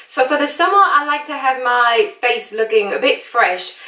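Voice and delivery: put-on British accent, chatty makeup tutorial; American doing a casual British accent. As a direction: talk them through it in a playful British accent.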